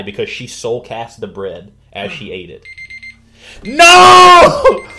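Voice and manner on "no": high, very loud wail of "no"; anguished shout. Real, not acted. Storyteller cut off as the hour is up, anguished shout. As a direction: cry out in dramatic protest. Crying out as time runs out.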